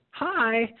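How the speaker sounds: lightly voiced little bird, high-pitched hi; a hint of a chirpy bird's hello; little bird greeting. Little bird answering the person on the balcony, lightly voiced high greeting.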